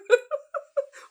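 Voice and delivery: a very high laugh through tears; teary laugh. Real, not acted. Moved by the stranger's offer to pray, a high teary laugh. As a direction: laugh while still crying.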